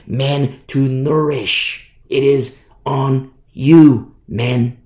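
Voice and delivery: ordinary talk, nothing marked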